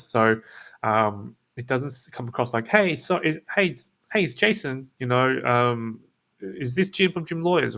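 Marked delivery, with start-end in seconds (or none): 2.7-7.8 salesy telemarketer. Shown as a demonstration. Mocking the pushy cold caller he avoids, salesy. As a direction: sell hard like a telemarketer. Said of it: "salesy telemarketing tonality".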